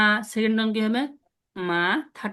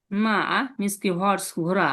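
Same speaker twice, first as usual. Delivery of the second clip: ordinary talk, nothing marked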